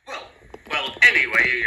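huffing. A narrator's reading. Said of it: "huffed Thomas".

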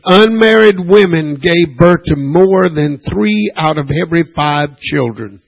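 ordinary delivery

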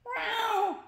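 high, drawn-out cat meow; piercing mock cat cry; attacking cat yowl. Narrator voices attacking cats.

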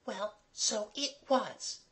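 put-on high, slow, soft girl's voice; stout girl. A narrator's reading. Mabel backing up the trick story, stoutly. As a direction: stand by it in a small high voice. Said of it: "said Mabel stoutly".

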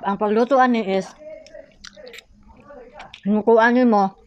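speaking between bites; talking while chewing. Real, not acted. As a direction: mouth partly full of food. Seen 2: Pink-top speaker bites small fried pieces between words throughout.

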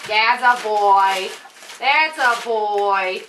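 مديح بطيء جداً وممدود؛ يمدّ الكلمات بحنان للكلب؛ يمدح كلبه ببطء وحنان; slow praise. Real, not acted. Praising dog tenderly.

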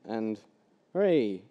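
ordinary talk

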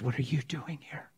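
hushed whisper; a soft pleading whisper. Retold or imitated. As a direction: whisper the real question to her.

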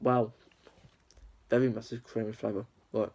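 chewing between words; talking while chewing. Real, not acted. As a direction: mouth partly full. Seen 1: Bites cake at -2s; lips closed, chewing while speaking afterward.

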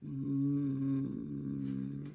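ordinary delivery, nothing marked

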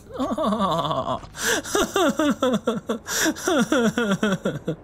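high breathless giggle; laughing fit. Real, not acted. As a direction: break down laughing. Laughing fit after joke.

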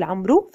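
عمرو with an "oo" ending; mispronounced final waw. Shown as a demonstration. Teacher warning against the reading, عمرو as amru. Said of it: "لا نقول".

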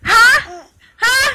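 high, loud, teasing. Real, not acted. Playful teasing of climbing baby.

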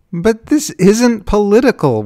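high, loud wail; anguished, pleading protest; desperate wail. A narrator's reading. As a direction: wail it in frustration. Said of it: "wailed Susan".

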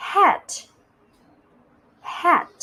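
"hat" high with a crisp final t; slow, clear model. Shown as a demonstration. Teacher models "hat" for children to repeat, slow and clear. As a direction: model "hat" as one careful, separate word. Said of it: "อ่านตามพี่ช้าๆช้าๆชัดๆ".

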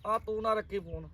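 ordinary talk

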